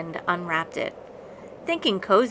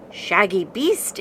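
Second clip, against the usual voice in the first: mild growl. A narrator's reading. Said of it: "it growled".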